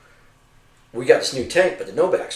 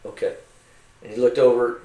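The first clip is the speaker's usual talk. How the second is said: plain reading voice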